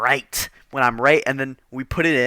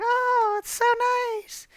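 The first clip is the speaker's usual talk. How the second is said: much higher sweet mock mom voice. Mom praising the finished door, high sweet voice. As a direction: chirp it like a delighted mother. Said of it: "She was like".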